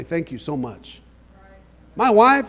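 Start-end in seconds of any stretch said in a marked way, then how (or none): 0.0-1.0 soft, slow, grateful thank you; careful thanks. Shown as a demonstration. Preacher showing how he thanks Paul, soft and slow. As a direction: say it gently so he feels thanked. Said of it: "I'm very careful to say".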